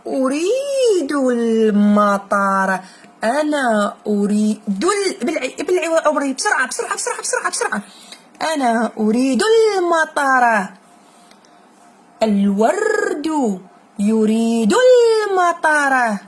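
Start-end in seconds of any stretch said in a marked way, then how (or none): 0.1-2.8 very slow, drawn-out reading aloud; a teacher's slow, careful model read. Shown as a demonstration. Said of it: "teacher models slow reading". 6.3-7.8 urging hurry. Real, not acted. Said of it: "teacher urges the pupil to hurry". 12.2-16.2 very slow, drawn-out reading aloud; a teacher's slow, careful model read. Shown as a demonstration. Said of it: "teacher models slow reading".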